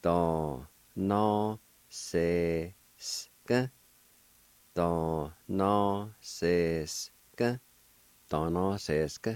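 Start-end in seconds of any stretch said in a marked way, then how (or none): 0.0-3.7 Da, na, se, sk, each sounded alone; slow teaching demo; syllable-by-syllable pronunciation. Shown as a demonstration. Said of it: "It's pronounced like this". 4.7-7.6 Da, na, se, sk drawn out evenly; measured teaching pronunciation; slow syllable demo. Shown as a demonstration. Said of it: "It's pronounced like this".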